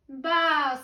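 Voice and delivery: sheep's bleat. A narrator's reading. Sheep after the thieves are caught, loud baa. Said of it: "said the sheep".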